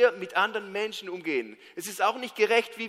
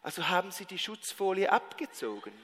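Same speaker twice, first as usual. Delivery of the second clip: soft, timid question; intimidated; 3 semitones lower, 7 dB quieter than usual. Retold or imitated. Intimidated phone agent asking an angry customer, timidly. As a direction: ask it meekly, imitating a cowed clerk. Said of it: "Sie fragt so ganz eingeschüchtert".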